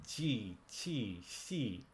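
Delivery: "Qi, qi, xi" with lips spread in a smile, tongue tip behind the bottom teeth; Mandarin qi and xi. Shown as a demonstration. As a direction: smile through "Qi, qi, xi". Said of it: "just think about smiling while you say it".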